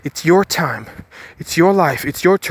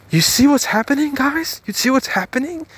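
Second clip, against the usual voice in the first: much higher, urgent call out; excited; 9 semitones higher than usual. Real, not acted. Vlogger urging his viewers to see the point, excited and high. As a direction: make them see it, rising eagerly.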